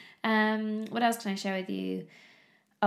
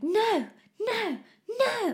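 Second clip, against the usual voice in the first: put-on high-pitched toddler refusal; Amandine; 13 semitones higher, much slower than usual. Toddler refusing the smaller banana, high insistent protest. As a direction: imitate a small child's insistent squeal of no. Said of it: "Amandine was like".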